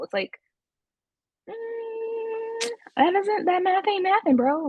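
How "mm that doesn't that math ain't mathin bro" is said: high-pitched, loud disbelieving jab; mock skeptical. Host scoffing at his sidebars, mock skeptical. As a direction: squeal it out like it doesn't add up. Mocking his excuses as not adding up.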